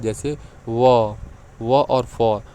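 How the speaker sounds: व held very slow and loud; व and फ voiced slowly and clearly; sounding out letters. Shown as a demonstration. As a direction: stretch व out for the class. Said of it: "जब आप व और फ को बोलेंगे".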